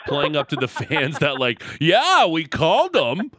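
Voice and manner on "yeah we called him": mock front office. Mocking the Jays' phone call.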